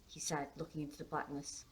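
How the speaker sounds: ordinary delivery, nothing marked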